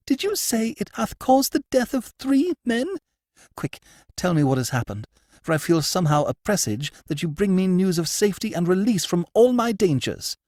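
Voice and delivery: high, urgent noblewoman; excited high lady's voice; agitated lady. Acted. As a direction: press him for news, voice high.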